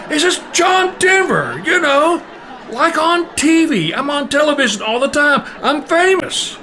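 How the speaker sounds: high, strained mock shouting; exasperated caller. Singer insisting to an oblivious mother, high exasperated mock shout.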